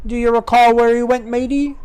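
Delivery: high, teasing pirate question; sly high-voiced pirate. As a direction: pitch it right up, prompting a mate. Pirate prompts his mate.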